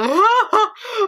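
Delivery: laughing wail, high; mock sob laugh. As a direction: fake a sob through laughter. Mock sobbing, acting out crying.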